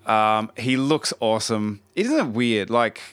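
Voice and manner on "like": ordinary talk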